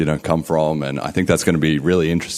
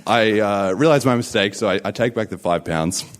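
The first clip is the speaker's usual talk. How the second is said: usual speaking voice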